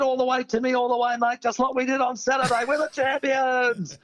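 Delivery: high, excited, rallying; pumped-up mate. Retold or imitated. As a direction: fire him up like a champion. Mate Greg voiced, pumped up.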